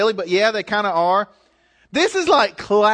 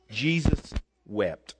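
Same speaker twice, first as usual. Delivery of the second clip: solemn hush; 10 semitones lower, 7 dB quieter, slower than usual. Acted. Solemn reading of shortest verse.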